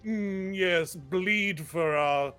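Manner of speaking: slow, haughty spectator drawl; mock Greek accent, savoring the bloodshed. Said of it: "That doesn't seem like a Greek accent".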